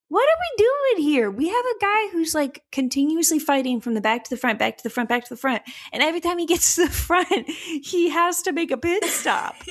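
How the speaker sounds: high incredulous rant; mock exasperated team voice; exasperated team boss. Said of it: "I was like".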